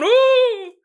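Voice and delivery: high, loud hammed-up owl hoot; spooky owl hoot. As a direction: hoot like a ghostly owl from the freezer. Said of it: "There's an owl going".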